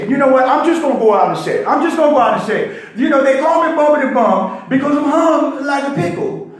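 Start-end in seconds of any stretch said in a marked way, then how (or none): 3.0-6.5 high, laughing through the words; cracking up at his own joke; laughing confession. Real, not acted. Caller laughing through an embarrassing confession on radio.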